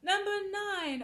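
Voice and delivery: loud, high announcement; preaching a rule. Acted. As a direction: call out the number from the pulpit. Announcing rule number nine.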